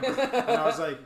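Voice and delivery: laughing through the words; amused laugh breaking in. Real, not acted. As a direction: laugh as you say it. Laughing while retelling.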